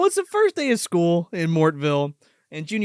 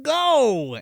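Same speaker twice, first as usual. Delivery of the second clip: sarcastic rant; 7 semitones higher than usual. Retold or imitated. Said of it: "he says something like".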